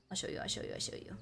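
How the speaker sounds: ordinary talk, nothing marked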